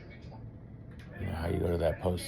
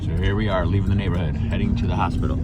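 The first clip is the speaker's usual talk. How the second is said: loud; 12 dB louder than usual. Real, not acted. Loud narration in car.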